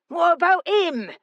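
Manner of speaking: put-on very high, aggrieved outburst in a local accent; outraged brawler objecting; indignant protest. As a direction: protest the unfairness, voice shooting up.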